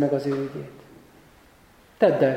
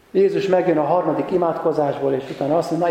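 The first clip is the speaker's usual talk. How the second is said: normal talking voice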